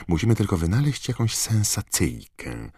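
high, sly, prying tabloid reporter; gossipy journalist voice. Acted. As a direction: drip with nosy glee. Rita's gossip voice continues.

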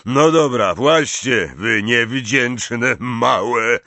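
loud, stammering gruff giant; irritated, growly character voice; growled grumbling. A narrator's reading. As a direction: grudgingly give in, slowing at the end. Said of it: "warknął Hagrid".

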